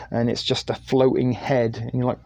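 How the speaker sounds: ordinary delivery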